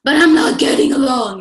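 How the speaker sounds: exasperated outburst. Retold or imitated. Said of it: "you're like".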